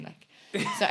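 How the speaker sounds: normal talking voice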